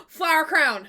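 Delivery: gasp, then call it out thrilled; excited gasp. Real, not acted. Spots a flower crown.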